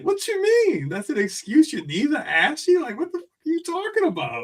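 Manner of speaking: mock a friend's bewildered outrage; squeaky-high disbelief, played for comedy; imitated incredulity. Acts out friend's baffled reply.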